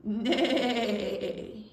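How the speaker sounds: long wavering whinny; horse neigh. Shown as a demonstration. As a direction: neigh with a rapid wobble like a horse. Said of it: "Can you do that with me?".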